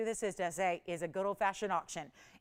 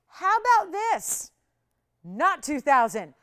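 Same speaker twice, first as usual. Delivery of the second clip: dramatic price pitch; 6 semitones higher, 10 dB louder, slower than usual. Real, not acted. Host dramatizes lowering price.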